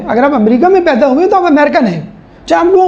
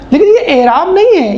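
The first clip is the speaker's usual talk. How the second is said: usual speaking voice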